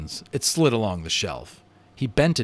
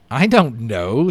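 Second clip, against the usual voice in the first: happy; 8 dB louder, slower than usual. A narrator's reading. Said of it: "feeling excessively happy".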